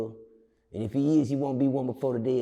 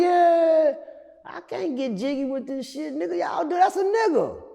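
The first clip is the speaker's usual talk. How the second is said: hyping crowd; 11 semitones higher, slower than usual. Retold or imitated. Inmates hyping up the dancer.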